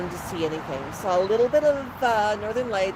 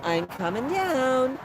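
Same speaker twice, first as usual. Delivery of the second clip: calling out to birds; 3 semitones higher, slower than usual. Shown as a demonstration. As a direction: gently announce yourself so nobody startles. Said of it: "I call out to the birds".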